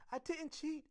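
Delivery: put-on high-pitched child's protest; indignant high little-girl voice; insistent. A narrator's reading. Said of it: "insisted Heidi".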